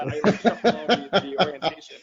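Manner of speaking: talking through a laugh; chuckling over the words. Real, not acted. Laughing while describing himself.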